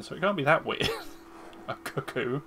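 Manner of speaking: ordinary talk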